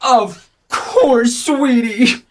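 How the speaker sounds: high-pitched eager yes; high, fond motherly exclamation; doting mom. Acted. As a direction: gush agreement to your child. Mother granting her daughter's request.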